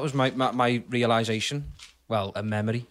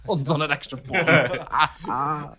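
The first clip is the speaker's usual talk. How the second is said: very slow drawn-out repeat; slow echo; much slower than usual. Shown as a demonstration. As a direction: stretch each word like an echo fading. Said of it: "Echo, echo".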